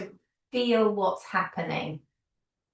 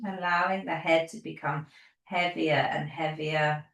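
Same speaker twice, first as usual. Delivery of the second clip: guided relaxation; 3 dB quieter than usual. Acted. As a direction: settle them gently into the body, unhurried. Leads a guided body relaxation.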